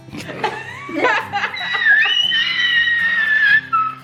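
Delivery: very high squeals of laughter; long shrieking giggle fit; hysterical laughter. Real, not acted. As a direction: laugh until it turns to shrieks. Family cracking up.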